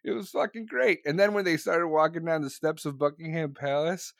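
usual speaking voice

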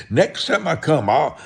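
ordinary talk, nothing marked

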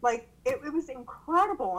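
normal talking voice

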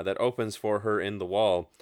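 ordinary talk

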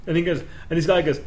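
ordinary delivery

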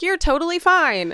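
high, breezy brush-off; dismissive. Retold or imitated. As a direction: wave it away lightly. Others dismiss her pain.

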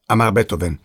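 plain reading voice

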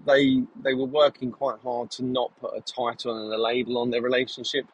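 ordinary talk

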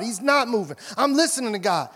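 normal talking voice

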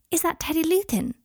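high-pitched witch, smiling blissfully; beatific witch. A narrator's reading. A witch greeting a boy in the atrium, beatific. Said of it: "smiling beatifically at Scorpius".

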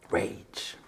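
a single breathy whisper of rage; whispered word. Real, not acted. Summing up her feeling to the audience, whispered.

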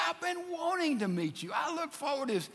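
high, softly eager greeting; eager Herod. Retold or imitated. Herod greets Jesus at long last, mock eagerness.

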